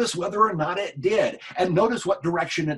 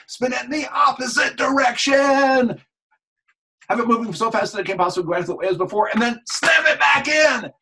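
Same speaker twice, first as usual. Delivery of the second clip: raised, driving coach voice; loud urgent commands; 8 semitones higher, 4 dB louder than usual. Shown as a demonstration. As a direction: push them hard, almost shouting. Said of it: "hypnotist commanding technique".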